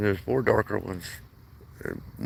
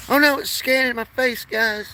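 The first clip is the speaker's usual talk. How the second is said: much higher, louder startled squeal; mock alarm; 4 dB louder than usual. Real, not acted. Streamer startled by face scan, mock alarm squeal. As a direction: act playfully panicked. Phone scanning his face.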